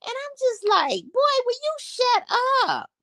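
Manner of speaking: high, squeaky retold outburst of disbelief; exasperated squeal. Gossiping to viewers about [name], exasperated high squeal. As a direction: squeal it like you can't believe he's talking. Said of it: "I said".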